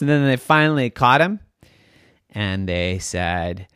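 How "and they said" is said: plain reading voice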